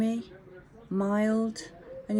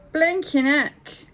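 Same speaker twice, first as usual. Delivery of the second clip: slow, loud, high mock London exclamation; London accent; 8 semitones higher, 6 dB louder, much slower than usual. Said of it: "in his London accent".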